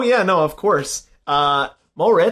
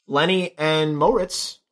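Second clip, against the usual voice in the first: usual speaking voice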